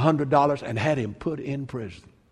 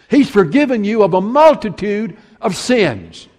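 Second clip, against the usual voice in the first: louder, ringing sermon climax; forceful preaching; 5 semitones higher, 8 dB louder than usual. Acted. Preacher proclaiming forgiveness to his flock, ringing and loud. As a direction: preach it out to the congregation.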